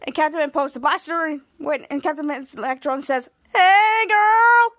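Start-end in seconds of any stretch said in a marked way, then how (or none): 3.5-4.7 loud, high drawn-out greeting; called-out greeting. Retold or imitated. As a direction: call out a big exaggerated hello. Captain's Electron greets Chopper in story.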